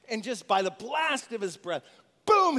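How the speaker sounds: usual speaking voice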